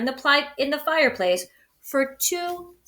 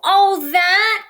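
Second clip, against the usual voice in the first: high, louder cry; 9 semitones higher, 6 dB louder than usual. Acted. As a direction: blurt it out in relief. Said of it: "cried Bear".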